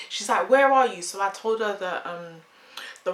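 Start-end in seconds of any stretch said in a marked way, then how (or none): 0.5-1.0 high, loud urgent question; anxious sibling calling out; worried sister. Retold or imitated. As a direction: demand her whereabouts in alarm. Sister panicking on the phone.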